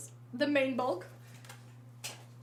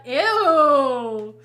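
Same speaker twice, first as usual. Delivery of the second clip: disgusted ew; 11 dB louder than usual. Real, not acted. Disgust at weird game ad.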